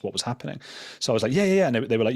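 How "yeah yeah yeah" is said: rapid, high, louder eager yeses. Retold or imitated. Intern grabbing first task, rapid eager yeses. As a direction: jump at the chance, too keen. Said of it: "so excited to have something to do".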